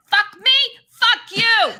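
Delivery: high, loud neighbor's yell; imitated shouting. Neighbor screaming out in the middle of the street, imitated shouting.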